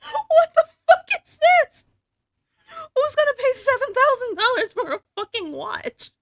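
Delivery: very high, loud, squealing disbelief; incredulous shriek. Real, not acted. Shopper balks at a seven-thousand-dollar watch, incredulous shrieking. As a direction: squeal in outraged amazement.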